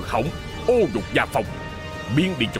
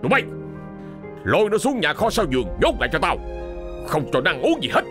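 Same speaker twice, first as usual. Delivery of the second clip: high, heated orders to servants; merciless, shouted sentence; angry command; 8 semitones higher than usual. Acted. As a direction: bark out the punishment, building in rage. Furious master orders daughter locked up.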